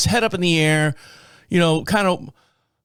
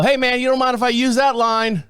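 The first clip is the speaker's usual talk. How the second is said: teasing; 7 semitones higher than usual. Retold or imitated. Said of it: "And I go".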